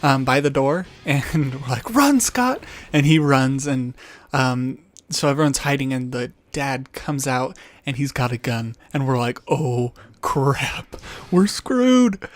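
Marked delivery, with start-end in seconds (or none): 1.9-2.6 high, loud warning cry; panicked call to a friend at the door; urgent shout. Retold or imitated. Friends warn nervous kid. 9.5-12.3 mock panic. Dad appears with a gun.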